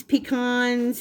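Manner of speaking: "pecans" said pee-KAHNS, open ah. Shown as a demonstration. Baker offering pronunciations to viewers, "pecans" as pee-KAHNS. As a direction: stress the second syllable of "pecans", pee-KAHNS. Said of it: "however you pronounce it".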